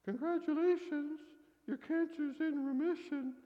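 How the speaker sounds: high, loud, bright-voiced doctor announcing good news; oddly cheerful doctor delivering the news; cheery doctor.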